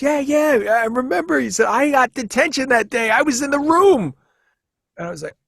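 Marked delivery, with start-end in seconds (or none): 0.0-4.1 eager classmate. Retold or imitated. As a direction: chime in like a thrilled old schoolmate. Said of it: "He goes".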